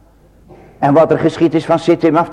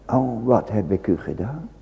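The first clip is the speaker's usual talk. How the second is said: "wat heb ik u gedaan" sighed low and much softer, rising on wat; hushed lament; 4 semitones lower, 8 dB quieter than usual. Acted. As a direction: sigh it quietly to yourself. Soft echo of God's plea. (zacht en laag verzucht "wat heb ik u gedaan")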